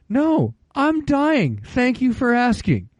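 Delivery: mock-dying answer pitched high and slow; sarcastic reply. Older man answering the kids, slow high sarcasm. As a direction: drip sarcasm, pretend you are perishing.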